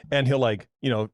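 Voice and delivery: ordinary delivery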